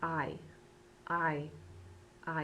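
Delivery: HAY said as "I"; silent H. Shown as a demonstration. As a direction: say "I" for hay, with no H sound. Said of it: "look at that word as I say it".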